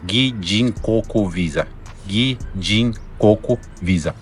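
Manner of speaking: Gijinkoku Visa said slowly, each syllable clear; slow and spelled out. Shown as a demonstration. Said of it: "Já vai gravando aí na sua cabeça".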